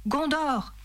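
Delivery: high, contained rallying call; narrated battle cry. A narrator's reading. Boromir charging in behind Aragorn, mild battle cry. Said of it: "cria Boromir en s'élançant".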